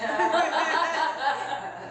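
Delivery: a burst of high laughter; high helpless laughter. Real, not acted. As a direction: laugh helplessly at being caught. Laughing at being busted.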